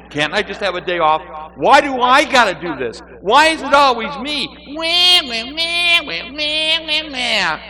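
high, loud, hammed-up whine; whining. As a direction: wail it like a sulky complainer. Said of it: "I was so busy whining".

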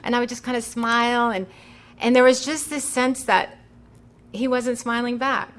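ordinary talk

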